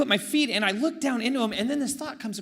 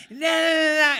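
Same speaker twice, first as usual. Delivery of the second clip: mock drunk yelling; 9 semitones higher, 7 dB louder than usual. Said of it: "starts mammering something at me".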